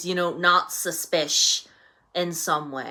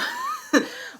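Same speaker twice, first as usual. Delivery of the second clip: high burst of laughter. Real, not acted. Laugh before closing question.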